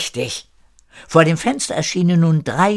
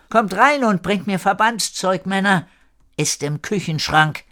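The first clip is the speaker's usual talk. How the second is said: higher-pitched summons, no real shout; calling over; 8 semitones higher than usual. A narrator's reading. Marina to the men at the window, calling over. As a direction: call instructions across the room. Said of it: "Marina rief den beiden am Fenster Verbliebenen zu".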